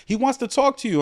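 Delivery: put-on very high girl voice insisting she's serious; drunk girl insisting. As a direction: squeal it like a tipsy fan swearing it's true. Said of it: "She's like".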